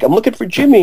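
high, urgent protest; retold frantic answer. Racing the streets for Jimmy, high frantic answer.

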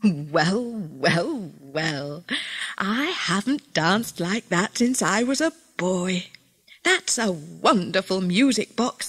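put-on genial storybook old fellow, marvelling; old Mister Gotobed, amazed. A narrator's reading.

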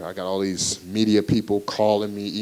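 ordinary talk, nothing marked

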